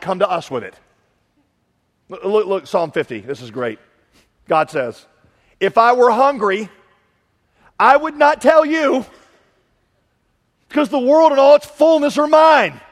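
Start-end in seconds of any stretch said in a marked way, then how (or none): 5.6-12.8 booming God voice. Acted. Preacher voicing God's words from the psalm, booming. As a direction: read it as God thundering.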